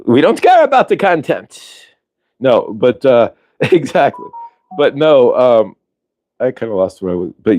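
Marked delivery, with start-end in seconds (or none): 0.0-1.9 high, hammed-up character voice; mock movie voice. Voicing sequel makers who don't care, high mock voice. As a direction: pitch it up in mockery.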